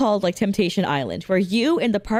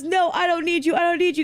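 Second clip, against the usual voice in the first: mock jilted man; 7 semitones higher, faster than usual. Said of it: "And then he goes".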